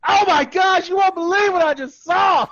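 frantic mock caller. Calling 911 about the clown, frantic shout. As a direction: yell at the dispatcher in mock panic.

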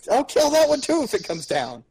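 high, loud furious threat; angry threat. Acted. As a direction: yell the threat in fury. Threatening the other creature.

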